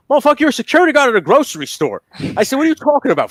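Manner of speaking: loud, high, comic outrage; incredulous telling-off. Retold or imitated. Tony to his grocery store friend, incredulous telling-off. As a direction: tear into a friend in mock exasperation. Retells scolding of self-important friend.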